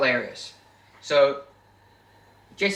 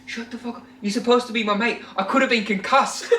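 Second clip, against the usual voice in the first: higher, whiny hammed-up outburst, laughing through it; mock upset yell; 8 semitones higher than usual. [name] whining at Jesse after being hit, mock outburst. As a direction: act out an aggrieved mate, half-laughing.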